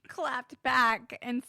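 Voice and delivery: ordinary delivery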